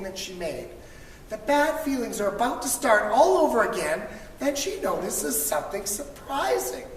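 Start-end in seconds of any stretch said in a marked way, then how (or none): none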